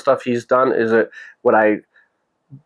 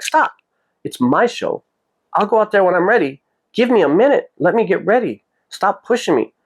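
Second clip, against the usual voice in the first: non-angry retold yell; 7 semitones higher than usual. Singer holding off his pushy staff, retold non-angry yell. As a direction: imitate a firm yell without anger. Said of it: "Not like an angry yell".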